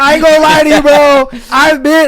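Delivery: loud and hyped; excited. Real, not acted. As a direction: hype it up. Excited confession.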